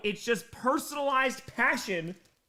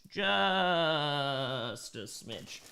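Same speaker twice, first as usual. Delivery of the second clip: very slow, stretched out; drawn out; 6 semitones lower, much slower than usual. Pretend. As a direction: drag every word out. Repeats the phrase two ways.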